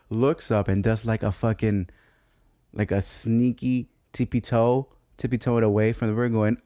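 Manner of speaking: ordinary delivery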